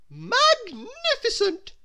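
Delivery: very high, slow, awestruck lady's voice; breathed in admiration. Acted. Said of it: "she breathed".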